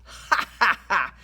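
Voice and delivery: staged "ha, ha, ha" laugh; mocking sailor laugh. A narrator's reading. Sailor scoffs at Rush, mocking laugh. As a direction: laugh in someone's face, deliberately. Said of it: "The sailor laughed".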